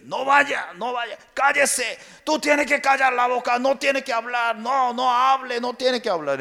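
high, forceful commanding voice; Satan silencing. Acted. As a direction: order them to shut up, high and pushy. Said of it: "Por eso Satanás, terrible".

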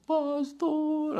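high, drawn-out mock sob; imitated tearful wail. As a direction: wail it like a weeping churchgoer seeking help. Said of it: "así llorando viene". (imitando un llanto agudo y lastimero)

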